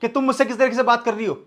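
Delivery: husband angrily throws her tone back at her; heated retort. Acted.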